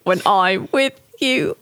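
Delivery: slow, drawn-out storybook bear voice; unhurried cartoon-bear reply; storybook bear impression. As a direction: draw it out slowly like a storybook bear. Answering as Pooh, Hundred Acre Woods.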